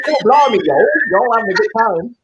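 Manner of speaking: high, loud cockney-meets-Southern mash-up; blimey and y'all in one hammed-up breath; mixed-up mock accent.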